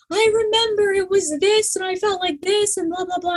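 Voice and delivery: very high-pitched imitation of crying; hammed-up weepy high voice; mock tearful wail. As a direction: squeal it out as if bursting into tears. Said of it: "instant tears".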